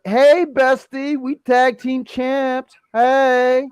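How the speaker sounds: high, drawn-out bestie voice; Kiana James. Retold or imitated. Kiana greeting her tag partner, mock high and drawn out. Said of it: "Kiana's like".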